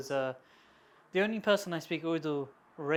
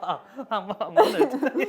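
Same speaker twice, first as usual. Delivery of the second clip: higher, louder, laughing; laughing mock honor; 7 semitones higher, 8 dB louder, slower than usual. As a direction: gush in jest through laughter. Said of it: "I was like".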